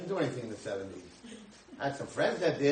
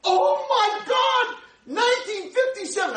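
much higher and louder, slow amazed cry; excited exclamation; 8 dB louder, slower than usual. Retold or imitated. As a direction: shriek it out like amazing news. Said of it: "this guy's all excited".

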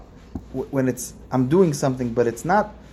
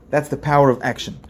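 ordinary delivery

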